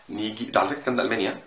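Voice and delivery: ordinary talk, nothing marked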